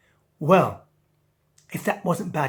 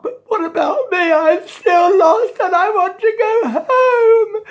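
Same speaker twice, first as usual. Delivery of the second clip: very high, loud wailing sobs; a tiny fish crying for home; crying angelfish; 13 semitones higher, 10 dB louder than usual. Acted. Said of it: "And Angel said".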